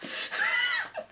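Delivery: very high squeaky laugh; real startled laugh; squeal laugh. Real, not acted. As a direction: burst into a squeaky giggle. Laughs after mishap.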